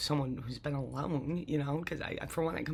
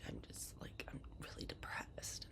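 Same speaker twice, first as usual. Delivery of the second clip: soft whispered despair, much softer; hushed brother's words; 15 dB quieter than usual. Retold or imitated. Retelling her crying brother's call, whispered softly. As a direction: barely voice his pain, hushed. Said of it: "And he's like".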